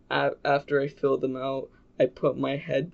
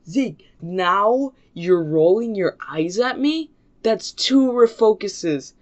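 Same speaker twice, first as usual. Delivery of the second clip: indignant scolding, rising higher and louder; raised-voice teacher reprimand; mock angry teacher; 4 semitones higher, 5 dB louder than usual. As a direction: imitate an outraged teacher handing out punishment.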